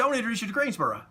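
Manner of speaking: high, very fast imitation; imitating a wrestler's hurried, eager introduction.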